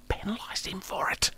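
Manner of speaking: conspiratorial whisper; hushed gossip whisper. Acted. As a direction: lean in and whisper the secret. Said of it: "conspiratorial aside".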